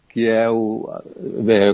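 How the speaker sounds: ordinary delivery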